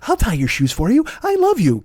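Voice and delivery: put-on high, gushing girlfriend voice; mock pleading girl. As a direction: squeal it like a lovesick sweetheart. Mimicking the girl again.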